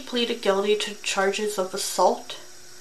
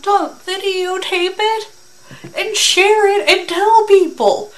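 much higher, louder and slow, stretched out in disbelief; incredulous yell; 10 semitones higher, 9 dB louder than usual. Real, not acted. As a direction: shout it like scolding a fool, dragging every word. Reactor lectures the criminal.

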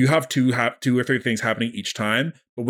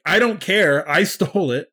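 higher, indignant retort; indignant Nami; 5 semitones higher than usual. Acted. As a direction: snap back that the loot was hers. Nami angrily refusing Luffy.

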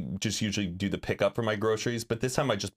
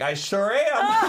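slow, higher mock hero voice; proud cop voice; 12 semitones higher, 4 dB louder, much slower than usual. Imitating the cart guy's proud reply. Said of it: "he's like".

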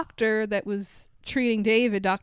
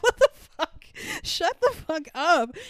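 shocked outburst; 7 semitones higher than usual. Retold or imitated. Reacting to the movie's line, mock shocked outburst. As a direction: blurt it out in shock.

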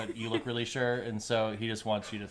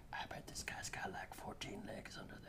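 much softer, unvoiced secret whisper; gnome whisper; 15 dB quieter than usual. Blumpkin leaning over to whisper to a friend. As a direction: lean in and whisper a silly secret. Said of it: "leans over and says".

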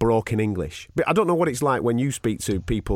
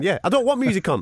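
ordinary talk

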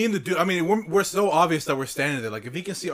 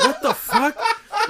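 very high, loud, drawn-out squeal; hammed-up incredulous squeak through laughter; acted shocked disbelief; 6 dB louder, much slower than usual. Bystander reacting to noises from the stall, mock shock. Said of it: "I was like".

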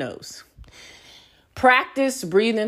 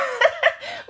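high laugh. Real, not acted. Cracking up at own story.

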